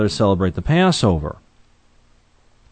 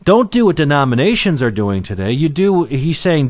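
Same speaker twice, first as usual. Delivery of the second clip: ordinary delivery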